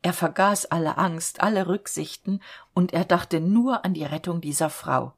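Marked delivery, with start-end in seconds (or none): none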